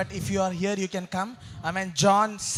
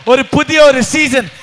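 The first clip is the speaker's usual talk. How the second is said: loud, ringing preacher's shout; full-force revival preaching; forceful proclamation; 4 semitones higher, 12 dB louder, slower than usual. Real, not acted. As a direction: declare it to the whole church, stretching the English.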